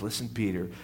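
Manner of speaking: soft, gentle commission; tender charge. Acted. Jesus charges Peter by the seashore, soft and tender. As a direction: hand it over quietly and tenderly. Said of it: "and he says".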